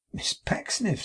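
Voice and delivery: very high startled call; surprised. A narrator's reading. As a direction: cry out her name, high and startled. Tom surprised.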